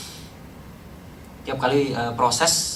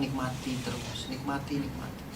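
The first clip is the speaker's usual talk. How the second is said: soft, hushed repeated encouragement; wife's gentle urging; 9 dB quieter than usual. Retold or imitated. Wife urging her worn-out husband on, softly. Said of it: "Istri saya bilang".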